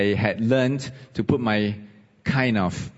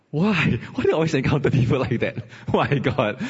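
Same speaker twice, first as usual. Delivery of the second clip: laughing; 4 semitones higher than usual. Real, not acted. Laughs at his bad luck.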